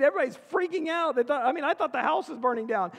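ordinary delivery

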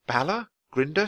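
plain reading voice

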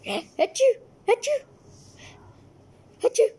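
loud acted sneezes; fake sneezes. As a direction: sneeze three times. Cat arrives, allergy, "get out.

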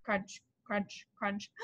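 soft low crunch crunch crunch; crunching sound. A narrator's reading. Reader voicing dragons munching tacos, soft. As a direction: whisper the chewing noises.

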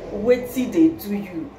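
Nigerian Pidgin Wetin dey do you, dropped casually; "Wetin dey do you" in easy Pidgin lilt; Pidgin version. Shown as a demonstration. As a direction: say it the Pidgin way, relaxed. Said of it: "now I say".

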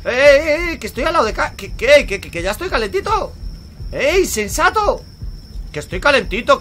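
high, loud stammering protest; indignant shouting. Real, not acted. Gamer telling game he is warm already, indignant shouting.

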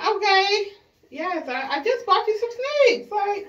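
high, loud exasperated protest. Real, not acted. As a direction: throw up your hands, voice jumping high.

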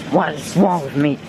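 ordinary talk, nothing marked

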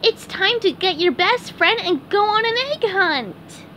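high cartoon invitation; eager SpongeBob. SpongeBob invites his friend to an egg hunt, eager.

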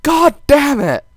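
high, loud, slow angry outburst; mock frustrated yell. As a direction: bellow your annoyance, hammed up. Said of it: "It's like".

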